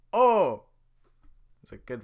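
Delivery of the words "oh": exasperated groan. Acted. Shrek groans.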